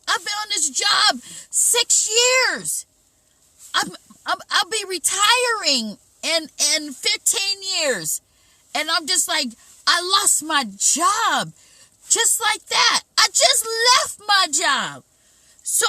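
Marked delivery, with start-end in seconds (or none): 0.1-8.2 panicked outcry. Retold or imitated. Panicking over lost job. 9.9-15.0 higher, louder, slower panicked wail; panicked cry. Retold or imitated. Speaker reliving the moment he lost his job, panicked higher and louder.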